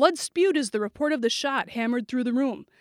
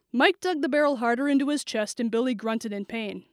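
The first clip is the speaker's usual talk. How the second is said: ordinary delivery, nothing marked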